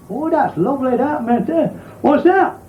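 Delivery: high, amazed woman's voice; a northern woman marvelling at the cab; wide-eyed woman. Acted. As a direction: play her gobsmacked and high.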